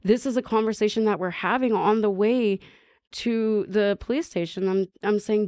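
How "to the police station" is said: ordinary delivery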